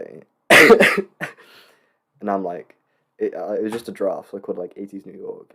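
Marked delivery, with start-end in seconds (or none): none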